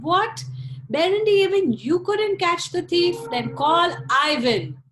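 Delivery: high, loud exasperated scolding; a king fed up with his sons; loud disbelieving king voice; furious Tsar. Acted.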